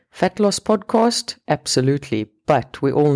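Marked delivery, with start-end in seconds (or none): 0.1-1.3 fat loss podcast said high and slow; mock surprise. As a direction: pretend to doubt the label, rising. Mock questions her own label.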